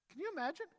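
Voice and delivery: usual speaking voice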